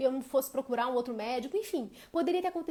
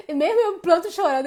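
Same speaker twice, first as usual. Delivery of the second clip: higher, louder, upset burst; loud, higher distressed burst; retold distress; 6 semitones higher, 13 dB louder than usual. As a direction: relive the panic, raised and loud. Retelling crying at the doctor.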